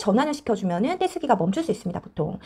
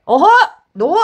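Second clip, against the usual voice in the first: scolding parent; 6 semitones higher, 9 dB louder, slower than usual. Retold or imitated. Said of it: "하면서 막".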